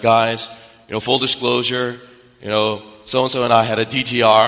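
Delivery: loud, level couple's announcement; public announcement. Retold or imitated. College couple telling the fellowship they are dating, public announcement. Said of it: "They make a public announcement".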